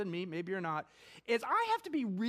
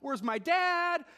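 mock-panicked cry, higher and slow; panicked girl; 9 semitones higher, 4 dB louder, slower than usual. Pastor retelling the girl's words after the crash, a higher, slow mock-panicked cry. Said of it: "she's panicked".

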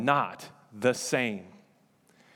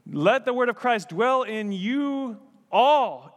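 slow, higher Southern drawl, stretching out you all; 8 semitones higher, 5 dB louder, slower than usual. Shown as a demonstration. Pastor showing the church how a Southern verse would sound, in a slow Southern drawl. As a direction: draw out the words in a Southern accent, leaning on you all. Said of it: "So if we lived in the South, we might say".

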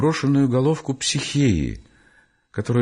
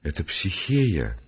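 low, slow, softly spoken admission; hushed, conscience-stricken reply; quiet; 6 semitones lower, 5 dB quieter, slower than usual. A narrator's reading. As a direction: say it softly and bashfully, head down. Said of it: "он произнёс тихо".

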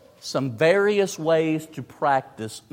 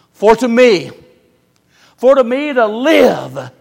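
for to me said loud and high; stress on for to me; 7 semitones higher, 12 dB louder than usual. Shown as a demonstration. Preacher demonstrating emphasis on Philippians, loud and high. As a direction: lean on for to me.

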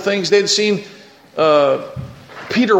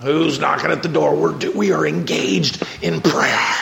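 hushed whisper. Acted. Church people whispering irritably at the knock, hushed. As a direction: hiss it so the prayer isn't disturbed. Praying church annoyed at door.

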